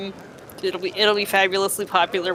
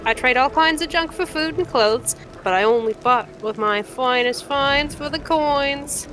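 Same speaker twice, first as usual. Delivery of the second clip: higher-pitched, scornful scavenger-merchant; feisty trader putting the stranger in their place; brash cave trader; 4 semitones higher than usual. Acted. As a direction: haggle like a proud junk dealer.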